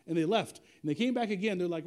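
ordinary talk, nothing marked

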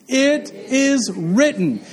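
slow, drawn-out declaration; slow emphatic call. Shown as a demonstration. Preacher leads the congregation in a slow, emphatic repeat. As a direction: lead the room, stretch every word. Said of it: "Everybody say it again".

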